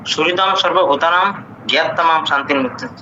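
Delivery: shloka recitation. Acted. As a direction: recite the verse as a sacred chant. Student recites Sanskrit shloka.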